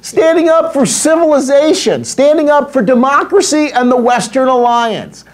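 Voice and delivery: rising outrage. Real, not acted. Building outrage list.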